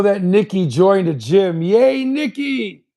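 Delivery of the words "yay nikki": cheering. Real, not acted. Cheering a friend joining gym.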